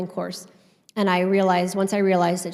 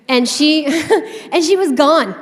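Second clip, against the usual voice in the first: high, loud, through real laughter; laughing; 6 semitones higher, 7 dB louder than usual. Real, not acted. Storyteller tells the audience the witch vanished, laughing. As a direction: break into a laugh mid-sentence.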